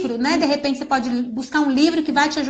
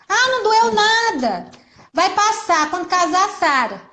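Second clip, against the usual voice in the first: higher, brushing-off mock mother voice; dismissive mom; 4 semitones higher than usual. Mother brushing off a child's hurt finger, dismissive. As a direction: wave the hurt away like it's nothing. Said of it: "a criança machucou o dedo".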